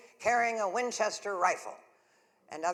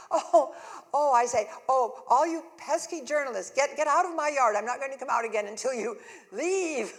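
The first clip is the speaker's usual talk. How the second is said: high, loud, fast flustered shooing; laughing half-panic at the crowd outside; 6 semitones higher, 10 dB louder, faster than usual. Retold or imitated. Homeowner shooing pesky journalists off her lawn, high and flustered.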